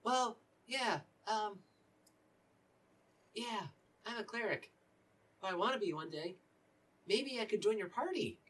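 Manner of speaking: put-on high, hopeful boy voice; pleading young cleric voice; eager young acolyte. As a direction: pitch it up like a keen kid asking to tag along. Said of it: "And he goes".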